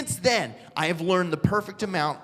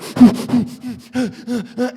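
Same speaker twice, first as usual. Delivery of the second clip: acted hyperventilating; loud, rapid puffs of mock panic; panicked gasps; 2 semitones higher, 6 dB louder than usual. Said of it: "I'm freaking out".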